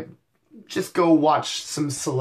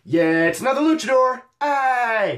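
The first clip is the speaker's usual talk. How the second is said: mock luchador; 9 semitones higher than usual. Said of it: "he mostly just seemed like".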